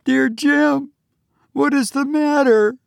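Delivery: put-on very high, frightened girl's voice; terrified. A narrator's reading. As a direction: plead in fright with pitch way up. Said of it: "said the girl in terrified tones".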